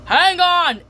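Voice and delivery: high, loud warning shout; Mister Grouper shouting a high warning. Retold or imitated. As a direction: yell it to the class. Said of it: "Mister Grouper says".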